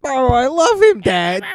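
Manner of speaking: mocking lovestruck Juliet. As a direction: whine it like a lovesick girl pleading to her father. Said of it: "As opposed to Romeo and Juliet, which is like".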